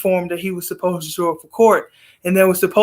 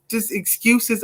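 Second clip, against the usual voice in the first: normal talking voice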